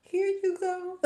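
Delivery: laughing as she hands it over; giggly here you go. Retold or imitated. Handing over twenty, laughing.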